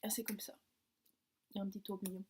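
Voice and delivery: soft whisper; unvoiced, close to the mic. Real, not acted. Inspecting candy closely.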